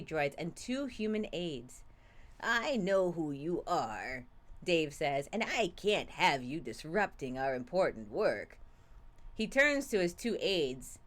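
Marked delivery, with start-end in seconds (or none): 2.4-8.6 slow, low villain menace; menacing villain. Acted. Voices villain Dave.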